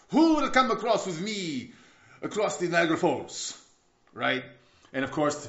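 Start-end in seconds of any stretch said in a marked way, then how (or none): 0.1-3.6 high, loud call to a crowd; showman's call. Acted. Tightrope walker asks the crowd for a volunteer, calling out. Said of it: "where Blondin asked the crowd".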